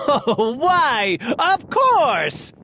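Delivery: rising high with delight; a hint of a laughing king; gleeful realisation. A narrator's reading. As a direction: crow it like a king struck by a mad idea. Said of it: "he began laughing".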